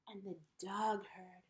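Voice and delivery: ordinary delivery, nothing marked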